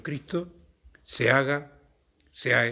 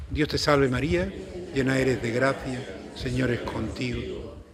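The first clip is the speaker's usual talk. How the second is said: slow, measured Hail Mary; prayer recitation; 3 semitones lower than usual. Real, not acted. Priest closes his talk greeting the Virgin, slow prayer. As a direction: pray it slowly, drawing out each phrase.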